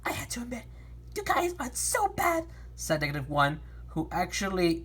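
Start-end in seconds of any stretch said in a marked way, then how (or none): 0.0-2.5 character voice. Acted. Character line in story.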